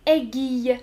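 "Aiguille" said as eh-gwee-y with the u glided; quiz option one. Shown as a demonstration. Teacher reads quiz choice one to the class, clearly. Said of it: "Je prononce trois mots".

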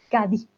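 Cadí said with no final z sound; Andalusian Cadí. Shown as a demonstration. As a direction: drop the z: Cadí, stress on the last í. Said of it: "al final dicen Cadí". (Cadí sin la zeta final, acento en la í)

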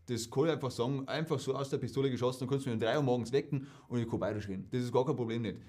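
quick, easy Bavarian dialect. Shown as a demonstration. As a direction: rattle it off in Bavarian like it's nothing. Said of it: "das Bayerische, das fällt mir leicht". (schnelles, lockeres Bairisch)

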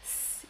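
"s" hissed on its own; soft C sound. Shown as a demonstration. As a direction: hold the "s" briefly, without a vowel. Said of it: "pour conserver le son". (« s » isolé, sifflé)

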